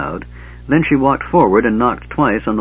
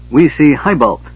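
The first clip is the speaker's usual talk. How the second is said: announcing; 5 semitones higher, 5 dB louder than usual. A narrator's reading. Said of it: "she announced".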